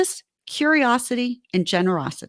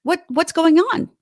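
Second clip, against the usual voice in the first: an acted tender wife's voice, pitched up; gentle caring wife; 4 semitones higher than usual. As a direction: coax him tenderly to open up. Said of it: "But just".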